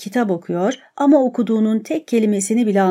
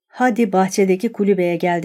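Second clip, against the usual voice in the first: usual speaking voice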